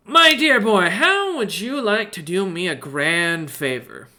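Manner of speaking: high, slow merchant voice; smug little merchant; witty period banter. Acted. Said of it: "he smiles up at you and goes".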